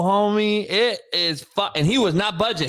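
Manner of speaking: ordinary delivery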